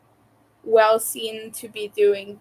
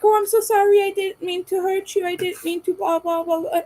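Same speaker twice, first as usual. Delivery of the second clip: mock overapologizer; 7 semitones higher, faster than usual. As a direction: gush a fake sorry, overdone and high. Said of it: "you're like".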